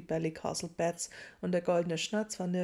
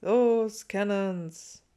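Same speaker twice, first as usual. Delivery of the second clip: loud but half-hearted cheer; a cheer with little conviction behind it; Harry humouring Ron with a loud, half-hearted cheer; 2 semitones higher, 8 dB louder, much slower than usual. A narrator's reading. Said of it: "hob halbherzig die Faust".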